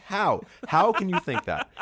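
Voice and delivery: disbelief. Retold or imitated. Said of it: "I'm just thinking".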